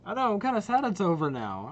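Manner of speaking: ordinary delivery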